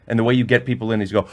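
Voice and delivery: ordinary talk, nothing marked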